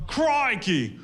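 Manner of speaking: high, loud mock Aussie "Crikey!"; mock Australian exclamation. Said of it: "or whatever the fuck they say over there".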